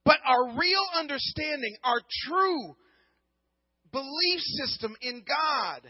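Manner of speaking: rising sermon climax, slow and emphatic; preaching at full force. Acted. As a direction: drive the point home to the congregation.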